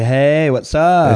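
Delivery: slow, stretched-out California vowels; California accent. Shown as a demonstration. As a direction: draw out every vowel, mock-Californian. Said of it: "We elongate our vowels".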